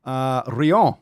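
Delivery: "Rion" in loud, high mock French; strange French accent. Shown as a demonstration. Host showing off a poster's name, mock French accent. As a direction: put on a French accent, "ree-OHN". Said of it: "say it with some strange accent".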